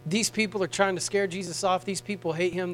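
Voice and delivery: ordinary delivery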